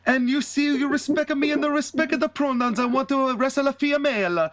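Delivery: high-pitched mock-Italian wrestler, indignant; Santina Marella. Santina protests to Mister Andersoni about the match, high mock-Italian voice. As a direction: put on a high, sing-song cartoon Italian accent. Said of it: "and Santina's like".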